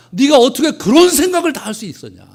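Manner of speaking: loud, high astonished cry; amazed exclamation. Retold or imitated. God marveling at David's heart, loud amazed cry. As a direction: burst out in awe as God.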